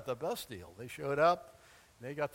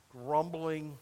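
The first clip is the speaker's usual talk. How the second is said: ordinary talk, nothing marked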